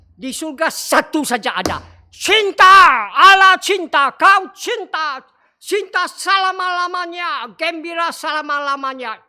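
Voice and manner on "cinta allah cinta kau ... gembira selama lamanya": loud, high cries of love; shouted proclamation. Real, not acted. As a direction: proclaim it to the whole church. Preacher proclaiming heaven is love. (seruan lantang dan tinggi)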